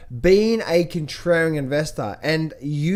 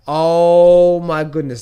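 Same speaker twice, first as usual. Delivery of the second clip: much louder, slow and stretched out; drawn-out exclamation; 8 dB louder, much slower than usual. Retold or imitated. Recalling his reaction to the crypto hype, drawn out and loud. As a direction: let the disbelief ring out.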